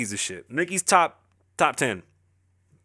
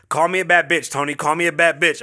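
louder, higher playful demand from the aunt; aunt's demand; 3 semitones higher, 5 dB louder than usual. Retold or imitated. Aunt teasing her husband for a compliment, louder playful demand. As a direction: boss him into the compliment. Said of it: "she goes".

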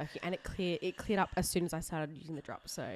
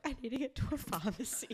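higher, mock tearful pleading; mock teary plea; 8 semitones higher than usual. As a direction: plead like a teary girl on the street. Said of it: "just, like, streaming cry".

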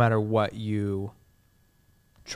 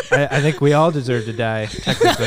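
laughing; 3 semitones higher, 9 dB louder than usual. Real, not acted. Laughing at dark joke.

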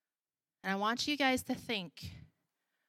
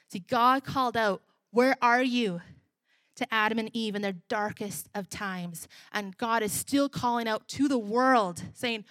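calling out; 6 dB louder than usual. Acted. Preacher builds to calling out.

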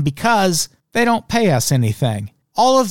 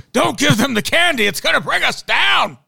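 high-pitched, hammed-up Southern pleading; pleading underling; 11 semitones higher than usual. Mid-level soldier begging the boss, hammed-up pleading. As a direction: play a soldier desperately begging, voice pushed up high. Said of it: "pleading with the boss to stop".

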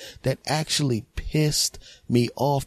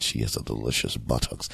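low, soft lecherous murmur; lower, creepier voice for a dirty thought; pervert inner voice; 11 semitones lower, 6 dB quieter than usual. Retold or imitated. His pervert inner voice ogling a woman, low and soft. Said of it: "And then after it said".

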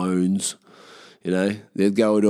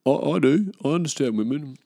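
higher, proud mock boyfriend; smug boyfriend voice; 6 semitones higher than usual. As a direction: preen like a know-it-all husband. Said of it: "They're like".

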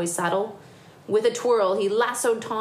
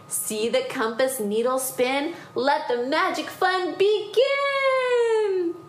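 slow incantation rising high; magic spell; 8 semitones higher, slower than usual. Acted. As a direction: build to a long, high final word. Grandude's spell in a story.

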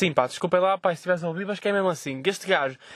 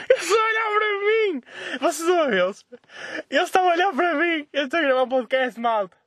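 shouting; 13 semitones higher than usual. Real, not acted. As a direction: yell it out in panicked disbelief. Yelling as passers-by stare.

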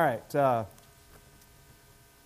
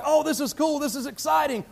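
bright higher pitched gush over the news; mock excitement; 7 semitones higher than usual. Churchgoer hearing of a coworker's mission, mock excited. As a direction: play an impressed churchgoer, pitch up. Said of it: "I can imagine you being like".

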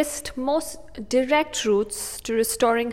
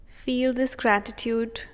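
soothing, unhurried breathing cues; calm meditative tone. Acted. Meditation continues.

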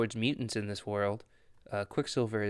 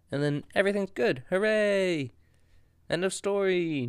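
sarcastic cheer; 7 semitones higher than usual. Real, not acted. Sarcastic recap of happy ending.

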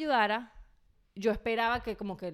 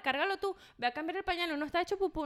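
frazzled orders; 7 semitones higher than usual. Retold or imitated. Ordering husband in chaos.

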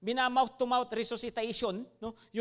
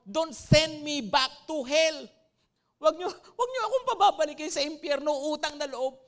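louder, higher begging; retold pleading shout; 4 semitones higher, 5 dB louder than usual. Patient begging doctors not to stop CPR, a raised mock plea. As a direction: plead to be spared from hell, voice raised. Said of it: "Ganyan po yung sigaw niya".

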